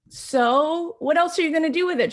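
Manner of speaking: high, loud, eager question, pitched well up; excited question. Retold or imitated. Retelling asking a friend about her sale, excited and high. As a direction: burst out asking with bright curiosity.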